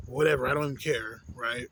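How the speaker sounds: ordinary delivery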